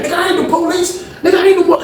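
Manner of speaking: high-pitched angry denial; defensive protest. Retold or imitated. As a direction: insist hard, voice way up. Prison yard quarrel retold.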